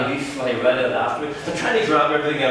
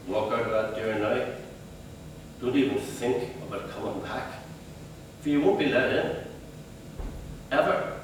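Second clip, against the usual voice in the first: ordinary talk, nothing marked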